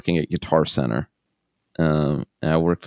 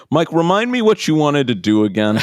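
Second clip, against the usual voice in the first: high, loud, mock-cheery phone voice; forgetful caller; 10 semitones higher, 5 dB louder than usual. Careers guy calls back a month later, cluelessly cheery. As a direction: exaggerate the clueless call-back, pitched right up.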